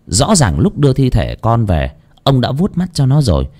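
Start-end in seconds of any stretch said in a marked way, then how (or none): none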